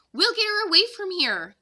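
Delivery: put-on a boy taking charge of the plan; keen young helper; Jack, eager. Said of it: "Jack said to Annie".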